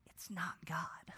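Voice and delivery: whispered aside, slowed down; hushed aside. Shown as a demonstration. Pastor whispering the point to his congregation, hushed. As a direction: drop to a whisper to let it land.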